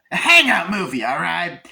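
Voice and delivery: loud, high-pitched exclamation; emphatic shout. Real, not acted. Emphatic exclamation of the main point.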